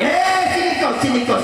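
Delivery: sharp high "Eh", then a scolding summons; mock angry mother calling her kid over. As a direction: imitate a mother summoning her child in anger. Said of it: "Kalau udah marah sama anak".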